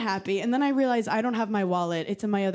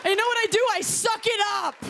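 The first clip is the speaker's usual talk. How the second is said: shouting through a laugh; 11 semitones higher than usual. Real, not acted. Comic on stage admitting her panic, laughing high shout. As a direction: blurt it out half-laughing, half-yelling. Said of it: "I just had a panic attack".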